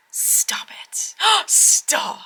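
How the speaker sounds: whispered gasp of delight; breathless whisper. Real, not acted. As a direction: hush it out in awe. Gasping at a card.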